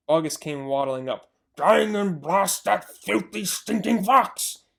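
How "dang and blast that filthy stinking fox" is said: slow, louder grumbling curse; cursing farmer. Acted. Bogus cursing the fox, slow angry grumble. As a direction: spit the curse out. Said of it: "he said".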